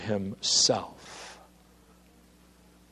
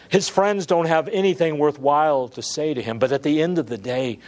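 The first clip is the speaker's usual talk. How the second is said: ordinary talk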